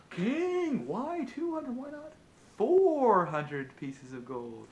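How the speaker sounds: high, sly lamp voice, slowing on four hundred; tempting lamp. Acted. Magic lamp teasing the king to double his wish. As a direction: coax him like a playful genie.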